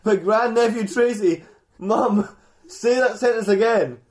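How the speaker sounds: high, loud, baffled repeat; mock incredulity. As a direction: challenge Mum as if it's absurd. [name] disbelieves his mum.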